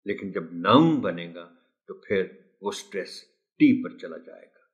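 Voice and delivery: ordinary delivery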